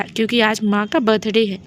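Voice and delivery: ordinary delivery, nothing marked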